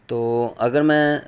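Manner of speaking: usual speaking voice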